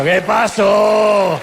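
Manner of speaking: lento y más fuerte, cómico; suéltalo a gritos, lento. Acted. Remate cómico gritado. ("¿Qué pasó?" gritado y lento)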